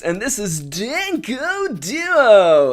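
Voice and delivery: big, swooping, over-cheerful show intro; put-on excitement. Host covering up his disappointment with the anime, forced excitement. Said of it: "I sound a little bit more excited than usual".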